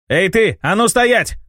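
громкий высокий окрик; крикни вслед убегающему; shouted order. Acted. Calling after fleeing criminal. (окрик вслед беглецу)

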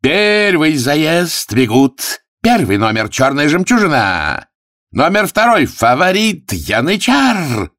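high, stretched-out announcer's call; race announcer. Acted. Arthur announces the first heat, high drawn-out call. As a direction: ring out each name slowly, high-pitched.

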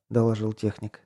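plain reading voice

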